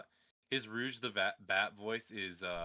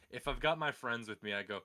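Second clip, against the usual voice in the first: ordinary talk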